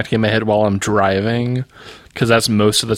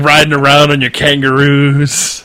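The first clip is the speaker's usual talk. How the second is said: mock taunt; 3 semitones higher, 6 dB louder than usual. Mocking New Zealand racism joke.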